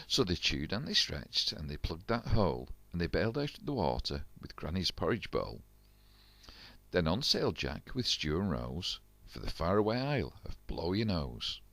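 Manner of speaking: ordinary delivery, nothing marked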